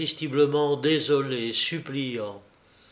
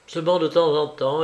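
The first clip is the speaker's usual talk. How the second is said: ordinary delivery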